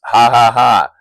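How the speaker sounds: loud hammed-up ha ha ha; a staged triple ha; mock laugh. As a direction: laugh it out like a cartoon hero. Character laughs in comic.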